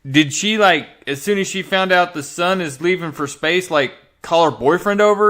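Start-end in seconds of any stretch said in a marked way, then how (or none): none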